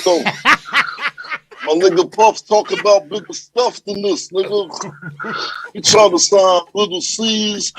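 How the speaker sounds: slow, heavy rapper impression. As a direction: drag the phrases like a big rapper.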